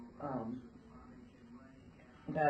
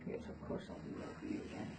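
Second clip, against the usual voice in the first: whispering; 12 dB quieter than usual. Real, not acted. Soft promise to horse.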